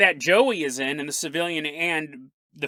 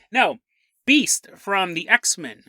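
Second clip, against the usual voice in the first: ordinary delivery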